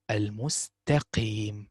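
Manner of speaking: "Al-mustaqim" slowly, light t and heavy qaf; slow correct pronunciation. Shown as a demonstration. Teacher slowly modelling "Al-mustaqim" for students. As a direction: drag out "Al-mustaqim" letter by letter. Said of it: "So you say sin, sa, ta, qa".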